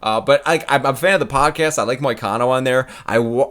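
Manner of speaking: usual speaking voice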